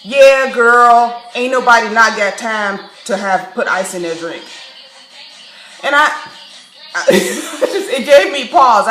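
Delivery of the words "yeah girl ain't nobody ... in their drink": loud, brassy "girl" voice imitating the barista; mock sassy barista, loud at the start. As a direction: play the barista laying on slang too hard. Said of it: "And she's like".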